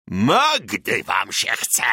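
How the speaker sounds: slow, drawn-out howl of rage. A narrator's reading. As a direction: howl it at the enemy, slowly. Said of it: "zawył Bartek". (przeciągłe, wściekłe wycie)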